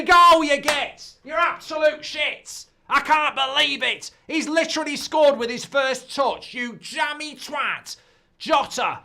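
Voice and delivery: high, loud, disbelieving yell; outraged shout. Real, not acted. Watchalong host reacting to Jota's first-touch goal, outraged shout. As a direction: bellow furiously at a lucky goal. Goal scored against his hopes.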